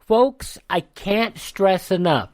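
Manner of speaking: ordinary delivery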